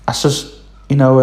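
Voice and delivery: usual speaking voice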